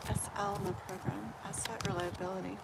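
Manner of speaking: reading the question softly to oneself; hushed murmur. Real, not acted. Murmurs question while shuffling cards.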